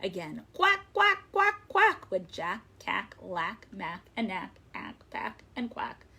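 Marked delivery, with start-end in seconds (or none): 0.5-2.1 high, loud duckling quacks; loud chorus of little duck quacks; ducklings quacking. A narrator's reading. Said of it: "just as loud as their little quackers could quack".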